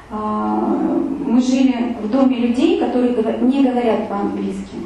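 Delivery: ordinary talk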